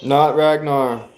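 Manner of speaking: ordinary talk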